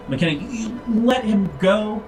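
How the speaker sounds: ordinary talk, nothing marked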